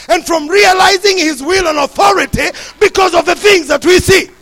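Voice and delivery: loud, rising preacher's build; preaching at full force. Real, not acted. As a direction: drive the point home to the congregation.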